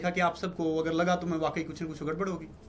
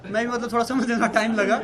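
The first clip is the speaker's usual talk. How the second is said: laughing; 6 semitones higher, 7 dB louder than usual. Real, not acted.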